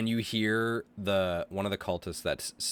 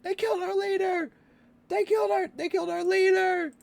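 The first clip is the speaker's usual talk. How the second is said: panicked shout; 4 dB louder, faster than usual. Acted. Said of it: "as he shouts that".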